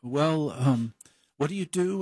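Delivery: mock a doubtful loan officer sizing him up; lightly voiced banker questioning; imitating young banker. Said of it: "she goes".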